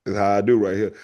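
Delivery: usual speaking voice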